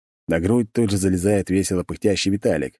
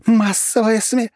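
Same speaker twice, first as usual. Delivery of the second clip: high, slow, strained casting cry; villain shriek; 4 dB louder, slower than usual. Acted. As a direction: shriek the spell out, cut off.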